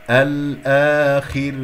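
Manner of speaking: الآخر slow with a thin final ra; drawn-out recited الآخر; light ra demo. Shown as a demonstration. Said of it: "راء مرققة".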